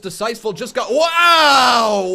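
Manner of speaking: loud, high wow of amazement; excited shout. Real, not acted. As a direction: yell it at the big move. Big move on live match.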